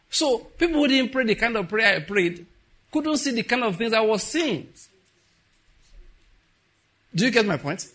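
high, loud preaching push. Acted. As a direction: challenge the congregation.

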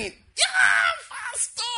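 very high, drawn-out cheering shout; esultanza imitata. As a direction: squeal like excited admirers greeting their pastor. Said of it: "They started jumping".

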